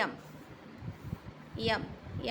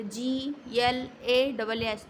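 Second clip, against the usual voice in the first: slow spelling; much slower than usual. Shown as a demonstration. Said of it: "जैसे glass".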